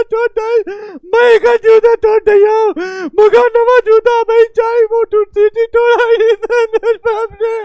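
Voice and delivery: put-on high wailing sob of a child; child sobbing about his broken whistle shoe; crying child. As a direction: wail loud like a kid whose toy broke. Child cries over broken shoe.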